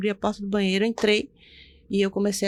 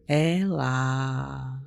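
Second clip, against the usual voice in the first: voce bassa tenuta a lungo; voce misteriosa; 7 semitones lower, 4 dB quieter than usual. Retold or imitated. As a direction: imita una voce dal nulla, grave e prolungata. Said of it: "de repente eu escuto uma voz".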